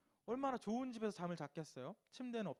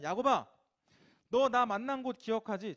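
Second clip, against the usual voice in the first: loud calling out; 4 semitones higher, 12 dB louder than usual. Acted. God calling Jacob back.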